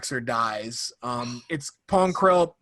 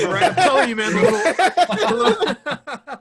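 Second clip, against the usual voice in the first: laughing; 13 semitones higher, 8 dB louder than usual. Real, not acted. Backing up the tattoo joke, laughing hard and high. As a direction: barely get words out through laughter.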